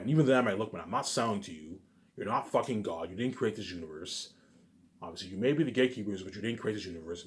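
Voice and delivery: ordinary talk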